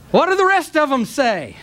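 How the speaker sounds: building from strong to shouted questions; fiery preacher's crescendo; rising shout. Real, not acted.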